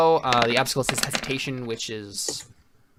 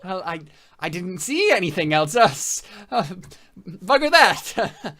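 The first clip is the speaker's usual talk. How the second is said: panicked squeak; 6 semitones higher, 6 dB louder than usual. Acted. NPC panics.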